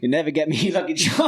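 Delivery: badly faked Irish leprechaun accent. Shown as a demonstration. Shy friend attempting it on request, giggly Irish accent. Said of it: "let me hear Irish".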